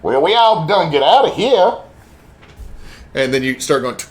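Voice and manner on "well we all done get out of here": lightly Cajun character voice; Cajun accent. Acted. Voicing Cajun character in scene.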